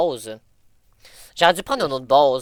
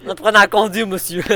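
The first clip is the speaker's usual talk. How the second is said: mock scolding; 5 semitones higher, 5 dB louder than usual.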